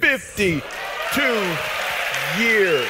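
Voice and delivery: drawn-out, slow amazed echo. Real, not acted. Comedian echoing the couple's fifty-two years, slow amazed. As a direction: stretch it out in disbelief.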